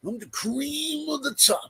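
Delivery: high, slow, drawn-out bragging line; lightly hammed-up heel boast; Harbaugh boast. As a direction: preen like a wrestler on the mic. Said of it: "going like this".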